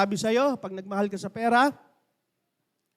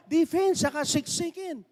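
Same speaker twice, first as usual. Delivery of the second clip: angry coach; 7 semitones higher than usual. Retold or imitated. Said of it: "Galit na galit yung coach".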